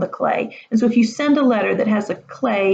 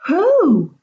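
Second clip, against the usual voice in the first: higher, louder eager demand; feverish excitement; 8 semitones higher, 5 dB louder than usual. Acted. Boys demanding the name, feverish excitement. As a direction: snap the question out eagerly. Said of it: "demanded in feverish excitement".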